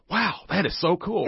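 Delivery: high, slowed-down wow of amazement; amazed exclamation. Retold or imitated. Student reacts to the pottery irony, amazed. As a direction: imitate a student wowed by the irony.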